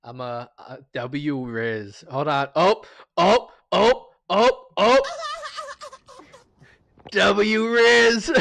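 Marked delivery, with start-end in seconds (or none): 2.6-8.3 squeaky high, loud excited chant; Rizzler voice. Retold or imitated. Streamer copying the Rizzler's hyped catchphrase, high squeaky shout. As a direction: hype it up like a meme kid. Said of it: "I can't say it's a Riz voice".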